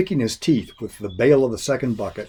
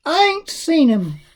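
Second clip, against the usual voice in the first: put-on high, slow stubborn old lady; Grandma's voice; 4 dB louder than usual. A narrator's reading. Grandma lying to the monster, in a high slow old lady voice. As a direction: pitch way up for the tough old woman.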